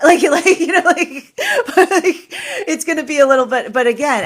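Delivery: helpless real giggles while talking; high, loud laughter swallowing the words; laughing. Real, not acted. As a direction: try to talk while cracking up. Cracking up mid-explanation.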